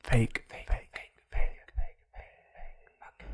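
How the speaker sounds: whispered jingle chant. Pretend. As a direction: breathe the word as a jingle. Chanting the segment name.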